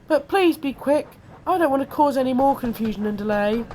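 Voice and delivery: high little engine voice; worried Percy. A narrator's reading. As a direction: plead in a high-pitched small voice.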